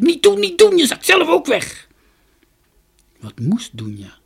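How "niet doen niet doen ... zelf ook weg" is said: very high, loud frantic yell; panicked high-pitched warning; shouted warning. A narrator's reading. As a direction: shout her back in alarm. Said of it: "maar Jorkos riep".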